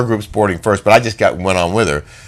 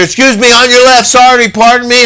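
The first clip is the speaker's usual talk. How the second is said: higher, louder calls to pass people; calling out; 11 semitones higher, 5 dB louder than usual. Retold or imitated. Rushing down the escalator, calling out politely to pass. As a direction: call ahead as you push through a crowd.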